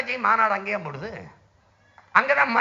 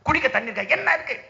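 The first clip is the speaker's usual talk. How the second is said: ordinary delivery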